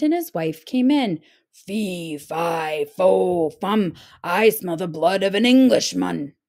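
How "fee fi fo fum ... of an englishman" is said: lightly voiced dim giant; a hint of a lumbering giant; dim giant cyclops. A narrator's reading. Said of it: "the giant said".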